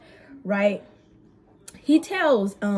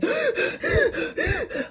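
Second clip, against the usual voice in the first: high gasp on the edge of crying; sharp sobbing gasp. Retold or imitated. Losing composure at work, tearful gasp. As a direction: catch your breath like you're about to cry.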